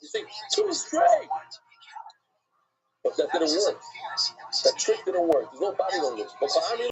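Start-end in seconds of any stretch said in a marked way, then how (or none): 0.5-1.3 high-pitched, drawn-out pronouncement; high, hesitant-sounding slow delivery; said another way. Pretend. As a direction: stretch STRAIGHT in a high voice. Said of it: "You wouldn't say it like that. You say".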